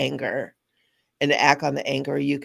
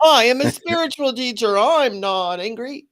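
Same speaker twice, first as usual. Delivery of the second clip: higher and louder, slowing into a huffy insistence; indignant denial; 8 semitones higher, 5 dB louder than usual. Retold or imitated. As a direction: play the offended spiritual teacher. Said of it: "And I would get so angry at that".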